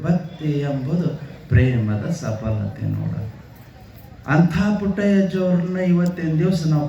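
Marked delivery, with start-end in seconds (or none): 0.0-3.3 lower, slow, reverent recitation; quoted saying, lower. Retold or imitated. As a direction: sink the voice low on ಪ್ರೇಮದ ಸಫಲತೆ ನೋಡ. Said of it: "ಪುಟ್ಟಯ್ಯಜ್ಜವ್ರು ಒಂದೇ ಮಾತು". (ತಗ್ಗಿದ ಧ್ವನಿಯಲ್ಲಿ ಭಕ್ತಿಯಿಂದ ಹೇಳಿದ ವಚನ)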